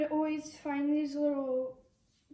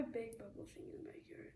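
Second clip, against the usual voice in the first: fast whispered aside; 4 semitones lower, 17 dB quieter than usual. Real, not acted. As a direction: rush it under your breath.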